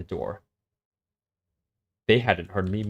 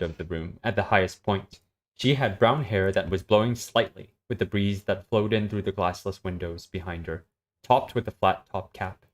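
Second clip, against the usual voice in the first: steady story reading, level and even; story narration; 8 semitones higher, 3 dB louder than usual. Acted. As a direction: read the scene out to a friend. S0 reads a story aloud.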